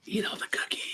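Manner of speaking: whispered temptation; breathy devilish whisper. Pretend. Whispered repeat, lollipop in mouth.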